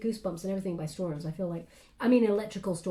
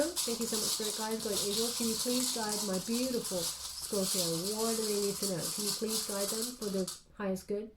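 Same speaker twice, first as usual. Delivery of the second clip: hushed, softer prayer to the guides; quiet prayer; 6 dB quieter than usual. Real, not acted. A reader quietly asks her spirit guides to guide the viewer, hushed prayer. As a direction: ask the angels softly for guidance.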